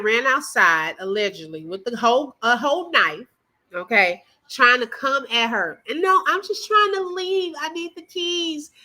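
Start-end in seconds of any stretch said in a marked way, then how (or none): 6.0-8.7 higher mocking imitation of her plea; host parodies guest, higher mock plea; mock pleading. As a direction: mimic her, pitched up.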